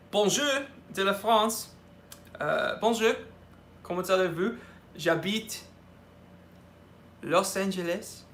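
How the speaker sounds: speaks simple French in an American-accented French; French phrases. Shown as a demonstration. Streamer greets French fan, speaking schoolbook French. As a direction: greets a French viewer in his best schoolbook French. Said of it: "your French accent is excellent".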